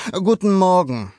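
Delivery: a friendly neighbor's reply; neighbor greeting. A narrator's reading. Neighbor answers Luca's greeting, friendly. Antwortete Signor Gamberetto.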